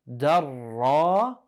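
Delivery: normal talking voice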